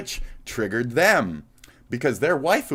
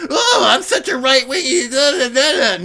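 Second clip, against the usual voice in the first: mocking whine; 13 semitones higher, 9 dB louder than usual. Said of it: "And like you're so fucking".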